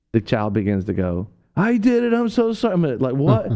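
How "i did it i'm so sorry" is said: put-on child's high wailed sorry; desperate confession. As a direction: blurt it out in panic. Child finally confessing, caught.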